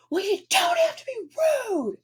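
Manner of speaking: high, huffy, offended voice; high mock-offended imitation of the caller. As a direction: sound wounded and prissy.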